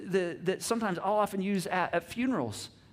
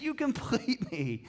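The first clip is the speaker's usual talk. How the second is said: repeated movie line; 6 semitones higher, slower than usual. Retold or imitated. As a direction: savour it once more, gently.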